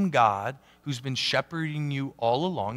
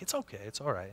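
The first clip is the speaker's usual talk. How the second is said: reassuring; 5 semitones lower, 13 dB quieter, faster than usual. Retold or imitated. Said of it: "I'm like".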